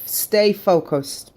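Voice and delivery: normal talking voice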